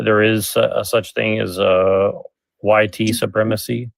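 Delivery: usual speaking voice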